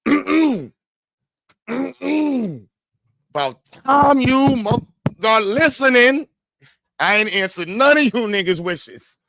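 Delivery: high, loud, slow booming God; mock God voice. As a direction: play God dismissing the prayers. Voicing God on the panel.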